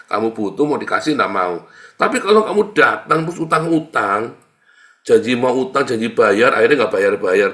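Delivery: loud scolding sermon; preaching at full force. Acted. Preacher scolding debtors who never pay, loud. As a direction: scold them for broken promises.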